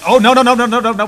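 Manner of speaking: loud, high panicked no's; panicked shouting. Real, not acted. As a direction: yell in sudden alarm. Game character about to die.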